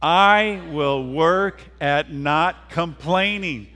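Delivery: loud, slow rallying recitation; emphatic pledge. Shown as a demonstration. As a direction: lead the crowd forcefully, word by word. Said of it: "we gotta do that again".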